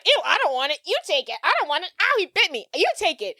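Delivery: high-pitched, imitated bickering; mock squeamish squabble. Retelling friends tossing the dog between them, mock squeamish. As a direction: squeal it in pretend disgust, passing it off.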